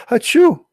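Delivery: loud high mock sneeze; a big theatrical achoo; fake sneeze. Demo sneeze for Allah.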